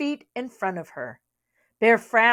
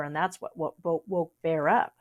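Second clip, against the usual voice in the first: usual speaking voice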